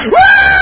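very high, loud woo; piercingly high celebratory holler; excited whoop. Acted. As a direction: whoop with joy like an ecstatic believer. Girl whoops over drowned army.

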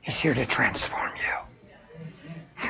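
slow, breathy stage whisper. Acted. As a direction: lean in and whisper it slowly. Sudden hushed aside in sermon.

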